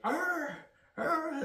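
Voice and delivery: high hammed-up brute grunt; cartoonish caveman grunt; mock grunt. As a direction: grunt like a big buff guy charging. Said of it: "grunting all the time".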